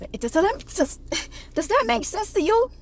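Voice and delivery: mock high voice. Mock high-pitched question.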